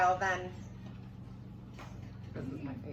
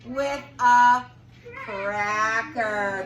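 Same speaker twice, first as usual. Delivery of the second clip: loud reveal; 7 dB louder, much slower than usual. Acted. Reveal of the answer.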